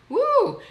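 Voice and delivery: high, loud "woo"; joyful whoop. Real, not acted. Streamer overjoyed at a new connection, joyful whoop.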